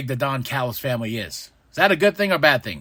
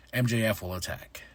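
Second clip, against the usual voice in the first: ordinary delivery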